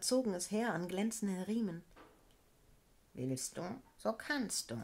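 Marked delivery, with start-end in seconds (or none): 3.1-4.8 a gruff beetle's promise; growled beetle voice. A narrator's reading. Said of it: "knurrte ihr Geselle".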